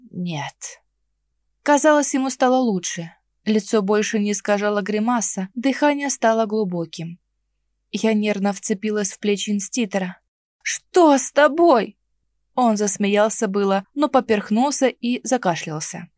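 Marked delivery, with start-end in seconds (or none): 0.1-0.8 тихо прохрипеть ниже и тише; выдавить хрипом через боль. Acted. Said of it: "прохрипел". 10.6-12.0 испуганно. Acted. Испуганный вопрос раненому.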